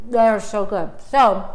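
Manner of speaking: talking through a mouthful; mouth full. Real, not acted. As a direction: say it round a bite of scone. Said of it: "talked with my mouth full here".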